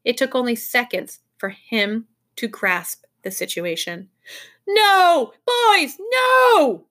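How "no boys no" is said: high, loud warning shout; urgent shouted warning; yelling. A narrator's reading. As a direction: yell to stop them at full voice. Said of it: "he yelled at the top of his voice".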